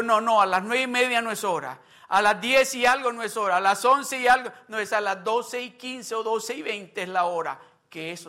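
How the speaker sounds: loud, high impassioned preaching; preaching at full force. Acted. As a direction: proclaim it with fervour. Preaches God's words loudly. (predicando con fervor, fuerte)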